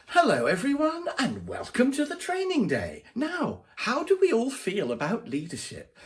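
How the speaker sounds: mock the over-cheery corporate trainer; training persona. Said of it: "she has a sort of training persona".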